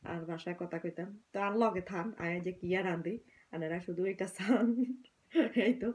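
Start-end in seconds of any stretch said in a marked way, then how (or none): 5.3-5.9 laughing. Real, not acted. Breaks into laughter mid-talk.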